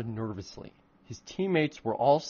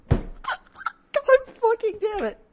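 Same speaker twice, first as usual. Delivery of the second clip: much higher and louder, slow, laughing despair; drawn-out real embarrassed wail; exasperated groan; 5 dB louder, much slower than usual. Real, not acted. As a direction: cringe out loud at your own writing. Author cringing at own fanfic.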